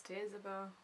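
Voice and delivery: soft, reluctant late toast; subdued toast from an upset old countess; Dowager Countess. Acted. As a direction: mumble the toast grudgingly, well under the others. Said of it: "you just hear the Dowager Countess".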